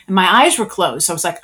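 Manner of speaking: ordinary talk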